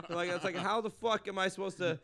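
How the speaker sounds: usual speaking voice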